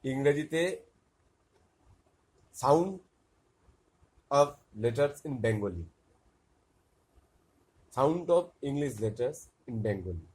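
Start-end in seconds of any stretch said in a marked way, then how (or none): 2.6-10.3 slow title reading. Shown as a demonstration. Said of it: "টিউটোরিয়ালটা হচ্ছে".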